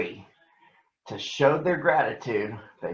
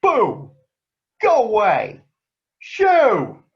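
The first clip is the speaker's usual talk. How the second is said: much higher, loud shouts to scare birds; excited shooing; 8 semitones higher, 7 dB louder, much slower than usual. Acted. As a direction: yell them away, arms waving. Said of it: "waved his arms excitedly".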